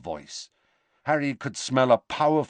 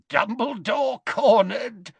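much higher, slow, wheezy gloat; gloating Amycus; 14 semitones higher, 3 dB louder than usual. A narrator's reading. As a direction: savour every word of triumph.

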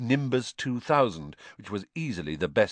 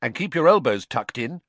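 advising; 4 semitones higher, 7 dB louder than usual. A narrator's reading. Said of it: "Ron advised".

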